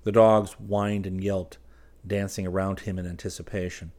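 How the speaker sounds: ordinary delivery, nothing marked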